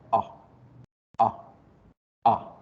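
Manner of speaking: short clipped O vowel; quick short English O. Shown as a demonstration. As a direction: cut each O short. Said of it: "Pero la corta".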